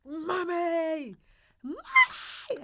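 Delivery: a very loud, high, hammed-up bellow of "Mummy! Mummy!"; imitating a bellow. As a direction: holler "Mummy!" like a daughter demanding attention. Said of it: "she bellows it out".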